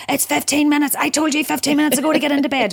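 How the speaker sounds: high, exasperated bedtime nagging; a stressed parent losing patience over bedtime. Retold or imitated.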